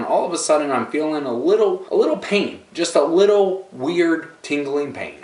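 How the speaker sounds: little by little, the pain creeps up. Acted. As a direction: stretch out little each time, building. Building up the mounting pain.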